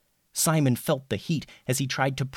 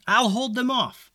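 high heroic shout; brave yell; 11 semitones higher than usual. Acted. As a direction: shout it high, standing firm. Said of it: "yelled Simon".

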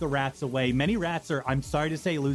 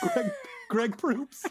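laughing through name; much slower than usual. Real, not acted. NPC name joke.

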